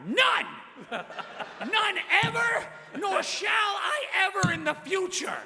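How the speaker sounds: high, loud comic declaration; loud emphatic shout. Real, not acted. Telling the roast crowd his answer, loud emphatic shout. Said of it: "let me tell you guys right now".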